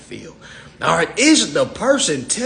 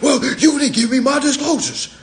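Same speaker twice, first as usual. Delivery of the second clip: high-pitched mock whine; high mocking complaint voice; whiny complaint; 9 semitones higher, 3 dB louder than usual. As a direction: mimic your younger self griping. Mimics his own complaint.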